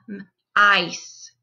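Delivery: M, ice split into onset and rime; segmented word. Shown as a demonstration. Teacher sounding out a word for students, segmented. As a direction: say M then ice as its own chunk. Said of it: "Do we hear short or long?".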